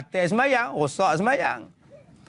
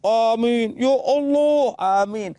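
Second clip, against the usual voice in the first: overdone praying; 2 semitones higher, 4 dB louder, slower than usual. Retold or imitated. Preacher mimics people who overdo prayer, loud hammed-up fervour. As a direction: exaggerate the prayer, raised and drawn out. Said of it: "Ada yang doa lebih-lebih".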